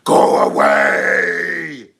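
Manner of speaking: very loud, long-drawn monster bellow; turning-monster voice. Retold or imitated. Hungry boyfriend turning on their partner, imitated monster shout. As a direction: bellow it like someone transforming into a beast. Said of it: "I could hear the voice. He's turning".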